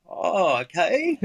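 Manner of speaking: mock reluctant acceptance, about to laugh; taken aback, playing along; retold uneasy acceptance. Retold reaction to eating dog.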